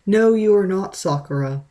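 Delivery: ordinary delivery, nothing marked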